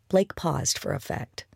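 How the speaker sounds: usual speaking voice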